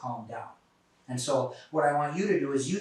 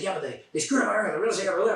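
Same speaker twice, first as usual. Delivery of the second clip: higher, fast and louder complaining; agitated kid; 6 semitones higher, 5 dB louder, faster than usual. Retold or imitated. Kid complains to narrator about clerk, fast and agitated. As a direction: rattle off a hot-headed grievance.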